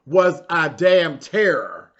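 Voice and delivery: ordinary talk, nothing marked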